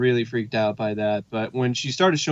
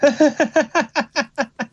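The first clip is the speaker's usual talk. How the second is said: very high, creepy giggle; eerie high-pitched mock laugh; 13 semitones higher than usual. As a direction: imitate a creepy laugh in high staccato bursts. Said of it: "definitely very creepy laugh".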